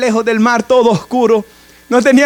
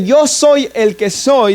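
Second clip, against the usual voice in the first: slow solemn quote; much slower than usual. Acted. Preacher repeats God's words.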